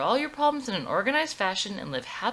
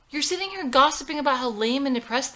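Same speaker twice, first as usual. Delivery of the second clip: indignant; 7 semitones higher, 6 dB louder than usual. Acted. Daughter snaps at hypocrisy.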